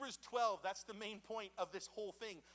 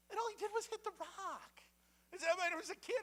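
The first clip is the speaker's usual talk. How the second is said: incredulous; 13 semitones higher, 3 dB louder than usual. Real, not acted. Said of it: "childhood disbelief".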